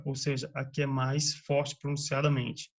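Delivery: ordinary delivery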